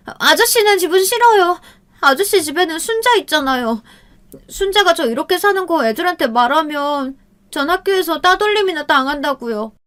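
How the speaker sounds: put-on high whining girl's voice; girl refusing to go to her friend's house; pleading girl. A narrator's reading. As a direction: whine and refuse.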